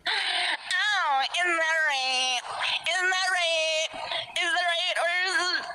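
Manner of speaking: high, sing-song menacing mother; creepy mommy voice. Acted. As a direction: coo like a sinister mother. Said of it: "I'm terrified".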